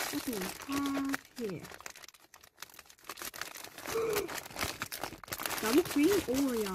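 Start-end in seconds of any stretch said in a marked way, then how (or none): 3.1-5.6 sharp breathy gasp of delight; surprised gasp. Real, not acted. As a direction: gasp at the treat. Sees the dessert.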